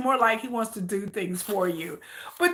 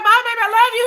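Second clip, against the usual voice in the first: higher sweet goodbye; sweet girlfriend; 8 semitones higher, 4 dB louder, faster than usual. Retold or imitated. Girlfriend cooing goodbye to [name] on the phone. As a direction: coo it down the phone.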